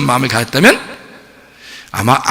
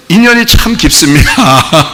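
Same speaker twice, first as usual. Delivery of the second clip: slow monk impression with a laugh; mock-solemn Buddhist monk; praying monk; slower than usual. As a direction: draw it out like a monk, amused. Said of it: "이러면서 가서 불공 드리신다고".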